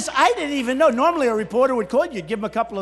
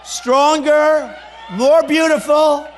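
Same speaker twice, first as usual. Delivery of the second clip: loud, slow, rising boast; slow rally shout, louder with each word; booming rally cry; 6 semitones higher, 9 dB louder, slower than usual. Real, not acted. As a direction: belt each word to the crowd. Rally boast, building crowd.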